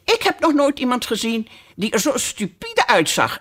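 a hint of piping disdain; violet's sudden insult. A narrator's reading.

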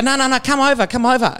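eager calling out. Retold or imitated. Urging neighbour to come over.

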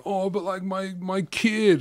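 high-pitched mock whinge, slowing at the end; put-on poor-me whine; whinging reality contestant. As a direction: mimic a pining parent. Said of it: "people on maths are like".

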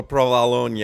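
PROVOLONE ending in an extra "nya"; mock-foreign provolone. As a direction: say provolone as "pro-va-LON-ya". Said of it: "adding a J in there".

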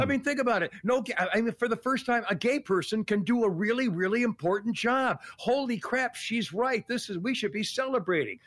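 high-pitched mock enthusiasm; sarcastic amazement. Guest mocking the announcement of a director, with sarcastic amazement. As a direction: marvel as if it were a huge breakthrough.

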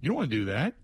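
ordinary talk, nothing marked

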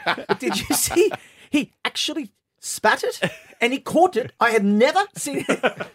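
put-on high, bright-eyed English accent, amazed; mock English accent. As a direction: imitate the Pommy mate's English accent, baffled. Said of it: "I can't do an English accent".

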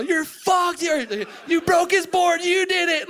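high, loud mocking yell; mock taunting shout. Friend taunting him after he broke the board, loud mock yell.

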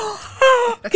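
sudden high "Ah" breaking into a laugh; surprised laugh. Real, not acted. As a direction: gasp of surprise, then laugh it off. Se entera que tiene mucho pelo. (sorpresa con risa aguda)

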